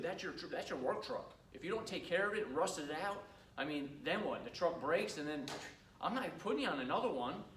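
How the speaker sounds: ordinary talk, nothing marked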